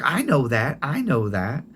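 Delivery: a grandmother covering up that she forgot; grandma's voice. Retold or imitated. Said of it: "She's like".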